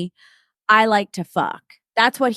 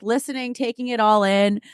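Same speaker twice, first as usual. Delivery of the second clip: ordinary talk, nothing marked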